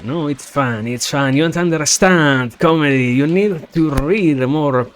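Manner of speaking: loud, rising mock outrage; mock scolding. Streamer scolds viewer who missed the joke, loud mock outrage.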